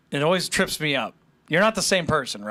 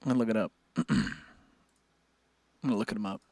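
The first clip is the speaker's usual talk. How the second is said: usual speaking voice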